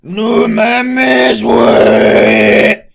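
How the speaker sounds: high, very loud Mexican yell; over-emphasize it like a caricature Mexican; drawn-out, blaring Mexican holler; exaggerated Mexican accent. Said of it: "they emphasize a lot that".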